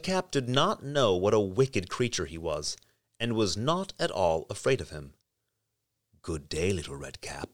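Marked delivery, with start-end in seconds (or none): 6.2-7.5 low, hushed wolf whisper; a sly wolf, breathy and low. A narrator's reading. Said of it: "said he".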